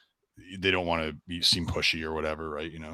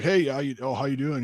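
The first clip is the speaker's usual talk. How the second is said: higher, louder casual hello; friendly greeting; 8 semitones higher, 4 dB louder than usual. Retold or imitated. Stranger greets someone in passing, friendly and upbeat.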